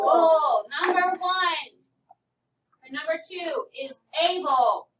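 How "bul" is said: "bull" as a loud, isolated "bul" sound, schwa before L; syllable sound. Shown as a demonstration. Teacher giving the class the B L E sound, loud "bull". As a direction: sound out "bull" strongly as the word ending. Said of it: "Which says".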